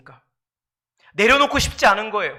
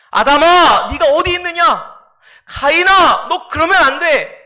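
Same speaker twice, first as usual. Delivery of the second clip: loud, raised preaching cry; God's booming rebuke; 5 semitones higher, 10 dB louder than usual. Acted. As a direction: thunder it out as God confronting a sinner. Preacher voices God confronting sinners.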